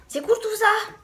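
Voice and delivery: high, loud burst of enthusiasm; excited cheer. Acted. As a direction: exclaim it high and loud, delighted. Acted cheer at teacher news. (exclamation enthousiaste, aiguë et forte)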